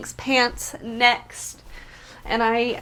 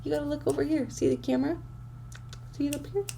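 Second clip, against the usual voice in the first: gentle coaxing; 4 semitones higher, 10 dB quieter, much faster than usual. Real, not acted. Softly coaxing dog to look.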